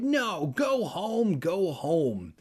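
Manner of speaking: high pleading go home; pleading cry. Acted. Kermit shooing Dogface off the yard, high plea. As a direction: shoo the dog away, begging. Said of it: "Kermit cried".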